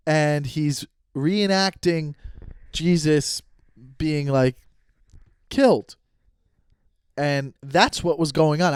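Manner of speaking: usual speaking voice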